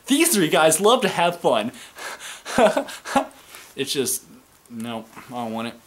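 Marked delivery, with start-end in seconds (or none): 0.1-1.7 high, loud mock commentator voice; cheesy commentary line; hammed-up announcer cheer; corny announcer. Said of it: "where it's like". 1.8-3.3 high breathy laugh. Real, not acted. Mocking laugh after quote.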